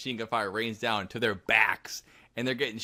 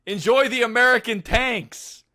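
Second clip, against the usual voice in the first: slow, much higher, louder mock yell across the wall; hammed-up soldier shouting down at fleeing enemies; retold soldier scream; 11 semitones higher, 7 dB louder than usual. Soldier yelling taunts at retreating troops, hammed-up loud yell. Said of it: "screams over the bastion. He goes, in German".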